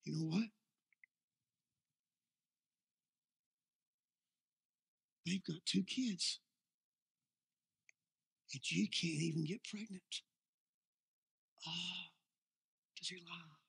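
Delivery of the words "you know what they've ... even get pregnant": soft, hushed voice of a devil luring you in; tempter's whisper. Acted. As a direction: whisper it like the devil in your ear. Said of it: "Oh, he whispers".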